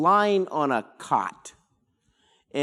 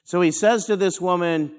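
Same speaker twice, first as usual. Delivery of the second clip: ordinary talk, nothing marked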